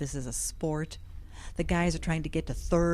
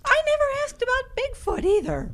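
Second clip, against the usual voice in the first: high indignant retort; 5 dB louder than usual. Acted. Shocked retort to friend.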